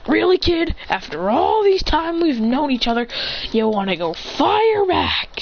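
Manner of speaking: acted exasperation; indignant protest. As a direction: protest as if wounded by the jab. Teacher protests the student's jab.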